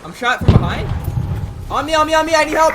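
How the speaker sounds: high, loud cry for help; urgent shout. Real, not acted. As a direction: yell it out, panicked. Shot, calling teammates for help.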